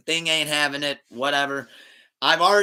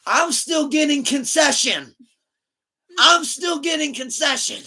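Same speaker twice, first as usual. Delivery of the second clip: high, slow, loud mock holler; comic full-voiced proclamation; hammed-up shout; 12 semitones higher, 5 dB louder, slower than usual. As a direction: belt it out high, stretching every word. Mimics line friend forgot, shouting.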